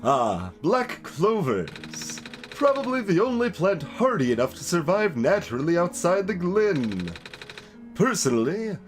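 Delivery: animated, rambling plant expert; chatty plant keeper. Acted. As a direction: muse aloud in a showy, swooping character voice. Voices plant-keeper character in game.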